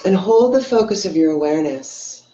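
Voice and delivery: unhurried phrases with long pauses between; guided meditation. Real, not acted. Meditation teacher leading online viewers into belly breathing, guided meditation. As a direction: ease them into breathing, leaving space after each instruction.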